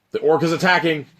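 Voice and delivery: sudden loud, high alarm call; excited alarm. A narrator's reading. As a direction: announce the attack like it's happening now. Dramatic narration of the attack.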